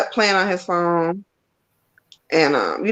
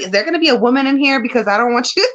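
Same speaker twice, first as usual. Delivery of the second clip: put-on quick, higher kid voice; imitating her son; 6 semitones higher, 3 dB louder, faster than usual. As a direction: rattle it off like a cheeky boy. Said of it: "He was like".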